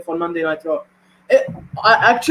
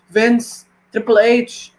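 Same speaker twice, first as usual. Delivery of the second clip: ordinary talk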